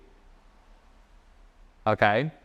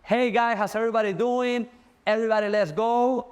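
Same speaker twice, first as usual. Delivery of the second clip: hype presentation voice; 9 semitones higher than usual. Shown as a demonstration. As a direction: fire up the room like a host on stage. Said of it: "If I'm talking to a crowd".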